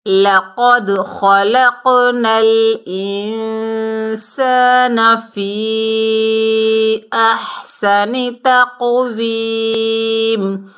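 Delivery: slow, drawn-out Quranic recitation; tajweed recitation. Shown as a demonstration. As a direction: chant each word slowly like in tilawat. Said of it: "Quran recitation demo". (آہستہ تجوید کے ساتھ تلاوت)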